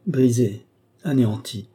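a touch of mournful weariness; doleful. A narrator's reading. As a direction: say it as a gentle lament. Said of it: "dit-elle d'une voix dolente".

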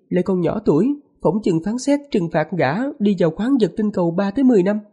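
high falsetto wife, calm and firm; man doing a woman's voice. Acted. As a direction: voice the wife high, matter-of-fact. Wife character voiced by narrator.

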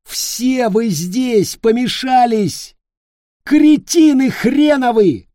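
high, slow furious yell. A narrator's reading. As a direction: scream the insults out. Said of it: "кричал". (яростный крик)

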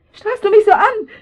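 high, loud frantic questions; rising panic. Acted. Mother panicking over her motionless daughter. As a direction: panic building as she sees the blood. Mother finds child hurt.

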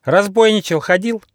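high, loud parrot squawk; jabbering parrot. Acted. Parrot taunts the trapped tiger, jabbering high. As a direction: chant the jeer shrilly like a parrot. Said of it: "затараторил попугай Лори".

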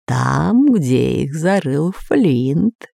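slow, drawn-out spirit answer; ghost voice. Acted. Spirit reveals where treasure lies, slow ghostly voice. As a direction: intone it eerily, stretching the words.

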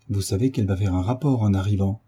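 usual speaking voice

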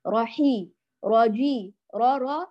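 راحي and راجي with a short ra vowel; one-count ra. Shown as a demonstration. Teacher models the correct single vowel count, short ra. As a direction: keep the ra of راحي to one beat, then move on. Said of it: "الراء الثانية تأخذ حركة واحدة".

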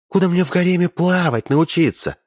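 high astonished question; surprised. A narrator's reading. Princess surprised when asked if she can swim, high surprised question. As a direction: sound honestly astonished. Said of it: "удивилась княжна".

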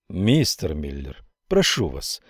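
calm him down gently; calm plea. Acted. Senator pleads calmly. (спокойная просьба)

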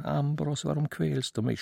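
plain reading voice

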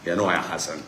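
normal talking voice

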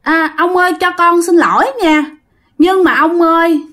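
put-on high-pitched child wheedling an apology; whiny little child. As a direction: coax like a small child, pitched well up. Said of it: "nói theo cái kiểu nhõng nhẽo". (giọng trẻ con nhõng nhẽo xin lỗi, cao)

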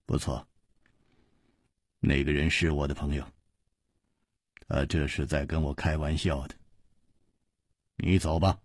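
unruffled, quietly dismissive; calm hero. A narrator's reading.

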